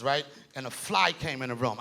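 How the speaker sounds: ordinary talk, nothing marked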